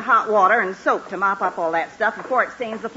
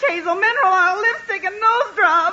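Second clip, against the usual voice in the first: building tearful wail; 9 semitones higher, 4 dB louder than usual. Acted. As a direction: list it off, rising higher and louder toward tears. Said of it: "crying over spilled iodine".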